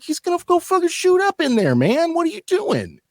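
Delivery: high, strained mock yell of disbelief; incredulous yell. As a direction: throw the voice way up in outrage at the coworker. Yelling at coworker in disbelief.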